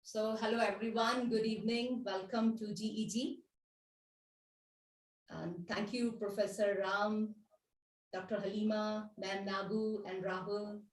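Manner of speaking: very faint, far from the mic; speaking too softly to hear; barely audible. Real, not acted. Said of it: "your voice is very low".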